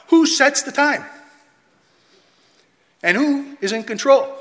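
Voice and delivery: high, loud challenge to the room; loud rhetorical question. Real, not acted. Preacher challenging the congregation, loud and high. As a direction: call out the question with force.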